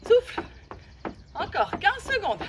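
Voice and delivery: loud breath cue, pushing hard; loud coaching call. Real, not acted. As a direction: shout it out to push the class. Coach calling out during workout.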